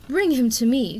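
loud, ringing royal command; commanding growl. A narrator's reading. Queen orders the prisoner brought, loud and commanding. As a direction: let it ring off the cavern walls. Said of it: "growled in a voice that rang off the cavern walls".

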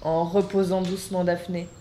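normal talking voice

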